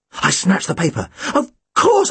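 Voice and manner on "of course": high, louder burst of realisation; shout. Acted. Boy to his friend, shouting with sudden realisation. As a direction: shout it out as it clicks. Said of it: "I shouted".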